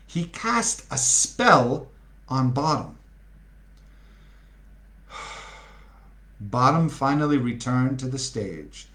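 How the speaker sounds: ordinary delivery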